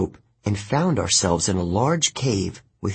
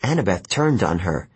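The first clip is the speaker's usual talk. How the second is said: ordinary delivery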